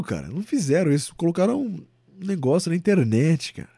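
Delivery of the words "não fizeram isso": ordinary talk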